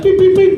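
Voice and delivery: high electronic beeping, imitating the watch. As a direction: chirp it like a smartwatch alert.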